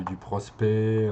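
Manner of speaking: ordinary talk, nothing marked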